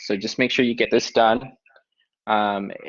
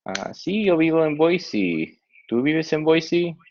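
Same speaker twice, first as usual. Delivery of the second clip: higher voice for the other student in the dialogue; answer, then ask back ¿Tú vives en Boise? in a higher voice; second dialogue partner; 7 semitones higher than usual. Acted. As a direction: read the partner's lines higher.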